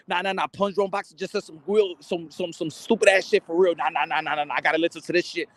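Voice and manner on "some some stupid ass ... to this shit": usual speaking voice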